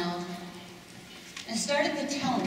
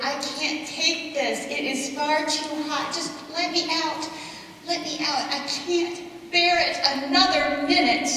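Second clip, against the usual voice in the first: higher, urgent pleas to be let out; begging, pleading; 6 semitones higher, 3 dB louder than usual. Acted. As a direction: beg him to open the oven, voice pitched up. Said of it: "I begged and I pleaded".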